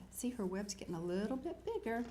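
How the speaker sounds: ordinary delivery, nothing marked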